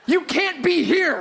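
high, slow mock outrage; hammed-up righteous fury, pitched high; steaming indignation. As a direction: protest it as if defending sacred ground. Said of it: "steaming now".

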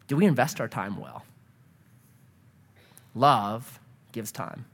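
usual speaking voice

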